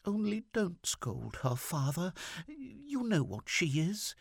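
very high, timid wifely plea; small high voice, gently begging; coaxing, timid. A narrator's reading. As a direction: coax him timidly in a high voice. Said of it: "in a coaxing, timid voice".

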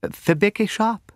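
high, slow, trembling voice. A narrator's reading. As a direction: quaver it out, afraid of her sister. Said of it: "trembling very much".

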